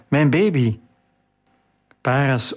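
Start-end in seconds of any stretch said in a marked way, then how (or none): 0.1-0.8 a touch of sobbing in "Mijn baby", lightly voiced; understated sob. A narrator's reading. Sarah mourning her lost baby, a mild sob. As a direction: let "baby" fall with a muted sob. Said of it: "snikte Sarah".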